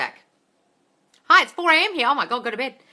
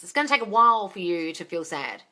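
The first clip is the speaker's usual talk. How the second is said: usual speaking voice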